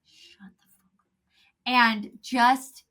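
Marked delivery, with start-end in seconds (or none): 0.0-1.2 whispered rebuke. Retold or imitated. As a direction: hiss it under your breath. Quoting mentor's rule.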